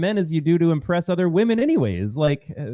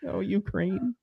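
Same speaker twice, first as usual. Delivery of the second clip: softer, slow mock voice crack; mock choked-up voice; 4 semitones higher, 8 dB quieter, slower than usual. Co-host mimicking Kimmel getting choked up, mock tearful. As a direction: parody a tearful sob over Ukraine. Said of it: "that's what made his voice crack".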